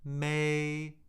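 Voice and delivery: "May" held high and flat; first tone. Shown as a demonstration. Teacher cycling the tones with a syllable, "May" high and level. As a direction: say "May" on a high, unchanging pitch. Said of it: "we'll do the first tone".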